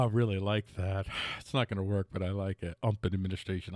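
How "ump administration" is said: Ump said as "ump", the T dropped from Trump; a sly mispronunciation, Ump for Trump; ump administration. Shown as a demonstration. Host coining a nickname for the Trump team, deadpan. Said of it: "I'm gonna say that from now on".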